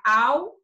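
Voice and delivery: "ao" said as a separate "al", rising and held; wrong syllable split. Shown as a demonstration. Teacher warning how alho would wrongly split, detached syllable. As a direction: hold "al" alone as a cut-off syllable. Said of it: "senão vai ficar".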